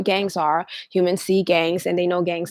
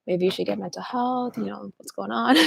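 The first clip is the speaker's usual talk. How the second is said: skeptical brush-off; 8 dB quieter than usual. Retold or imitated.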